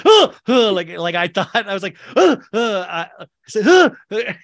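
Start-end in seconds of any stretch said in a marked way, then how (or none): 0.1-0.7 two loud, high frightened gasps; re-enacted jump-scare gasp; startled gasps. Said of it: "scare myself, be like". 2.2-2.9 re-enacted gasps of fright, high and loud; sudden spooked intake; startled gasps. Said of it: "scare myself, be like". 3.6-4.3 loud, high acted gasps of fright; re-enacted double gasp; startled gasps. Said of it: "get scared every time".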